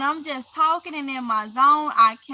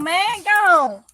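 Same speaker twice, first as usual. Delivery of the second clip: man, gone pushed louder and higher; a louder, higher second man, gone; louder shooing; 5 semitones higher, 8 dB louder than usual. Real, not acted. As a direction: shoo it off harder.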